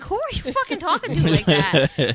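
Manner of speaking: loud, laughing mock outrage; retold indignant comeback. Friend answering a drunk pal's insult, loud mock indignation. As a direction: fire back loudly, half laughing.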